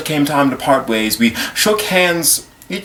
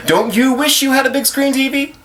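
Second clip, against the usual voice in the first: high-pitched TV ad voice; mock advertiser, pitched up high; commercial pitch; 10 semitones higher than usual. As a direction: sell it like a television jingle. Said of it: "only to find a commercial".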